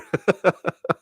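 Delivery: high amused chuckle; breathy run of giggles; laugh. Real, not acted. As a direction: crack up at the quote. Amused by own impression.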